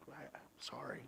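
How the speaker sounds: whispered, broken-off sorry; whispering sorry to God in prayer; whispered apology. Retold or imitated. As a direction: barely breathe the apology.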